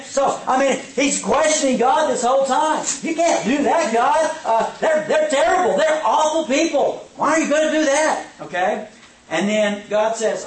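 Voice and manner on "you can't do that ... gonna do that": raised, insistent objection; pleading protest. Retold or imitated. Habakkuk objecting to God about the Babylonians. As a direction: argue back to God, worked up.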